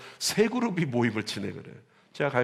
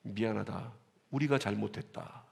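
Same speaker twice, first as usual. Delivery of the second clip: humble apology; 5 semitones lower, 8 dB quieter than usual. Retold or imitated. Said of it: "뭐라고 했냐면".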